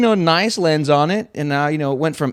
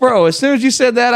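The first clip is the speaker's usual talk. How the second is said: ordinary delivery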